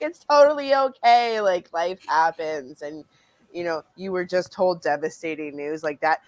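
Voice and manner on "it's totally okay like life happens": high, loud mock cheerfulness, easing down; pasted-on smile. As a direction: brush off bad news through a forced smile. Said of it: "I have this smile pasted".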